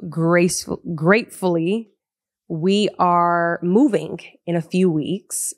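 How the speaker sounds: ordinary talk, nothing marked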